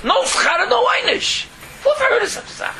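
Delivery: high, loud indignant cry; outraged exclamation. Real, not acted. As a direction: cry out at the absurdity.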